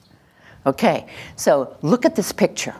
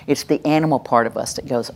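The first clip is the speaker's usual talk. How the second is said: ordinary delivery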